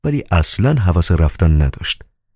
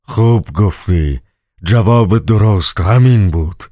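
terrible booming voice; 3 semitones higher, slower than usual. A narrator's reading. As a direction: boom it out slowly, like a dark lord. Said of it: "ولند با صدایی وحشتناک بانگ برآورد".

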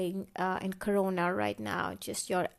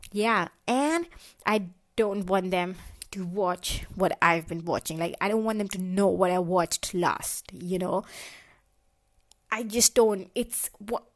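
ordinary talk, nothing marked

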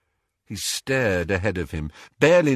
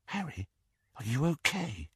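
much softer, concerned question; dropped voice; 4 semitones higher, 9 dB quieter than usual. A narrator's reading. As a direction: lean in and ask quietly. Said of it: "She dropped her voice".

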